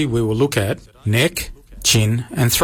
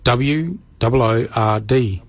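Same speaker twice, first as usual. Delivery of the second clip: usual speaking voice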